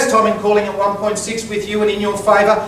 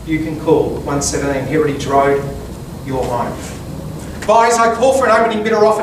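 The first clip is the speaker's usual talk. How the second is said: formal speech. Acted. Auctioneer's opening pitch.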